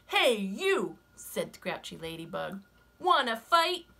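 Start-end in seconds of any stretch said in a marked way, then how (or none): 0.1-1.0 high, loud, grumpy bug; grouchy ladybug voice. Acted. Ladybug challenges snake. 3.0-3.9 high, loud, grumpy bug; grouchy ladybug voice. Acted. Ladybug challenges snake.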